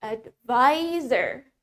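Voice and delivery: very slow "Advisor", syllable by syllable; "Advisor" drawn out as ad-VAI-zer; slow model pronunciation. Shown as a demonstration. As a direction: stretch "Advisor" so learners can join in. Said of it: "Let's say it with me".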